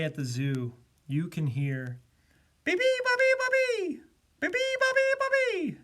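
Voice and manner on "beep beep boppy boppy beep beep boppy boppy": piercing high, loud repeated chirps; very high bird chant; bird call. A narrator's reading. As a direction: chirp like a noisy little bird. Bird's daily call at zoo.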